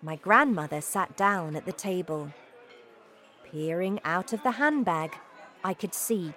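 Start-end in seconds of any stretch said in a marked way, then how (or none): none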